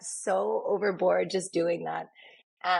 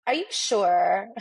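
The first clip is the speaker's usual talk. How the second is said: slow, louder mock doubt; drawn-out skeptical ask; doubtful question; 2 semitones higher, 5 dB louder, slower than usual. Clients doubting the eager makeup artist, slow and skeptical. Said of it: "they were like".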